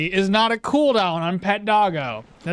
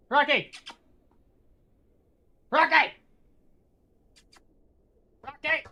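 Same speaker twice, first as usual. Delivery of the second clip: high-pitched call for the dog by name; 7 semitones higher than usual. Real, not acted. Streamer calls the dog over, high calling voice. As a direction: sing out the dog's name to fetch it.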